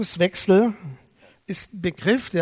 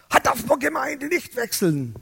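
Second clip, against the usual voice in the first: higher-pitched mock shout; retold scolding yell; 6 semitones higher than usual. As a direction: imitate her yelling an objection at you. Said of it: "schreit sie wieder mich an".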